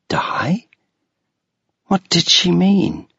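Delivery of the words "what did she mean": usual speaking voice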